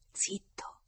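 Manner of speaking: soft whispered hush. A narrator's reading. She hushes him with a hand over his mouth, whispered.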